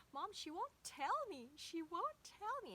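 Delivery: put-on high, frustrated little-boy voice; imitating her son. Mother voicing her son upset that a girl won't talk, high child voice. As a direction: whine it like a stumped four-year-old.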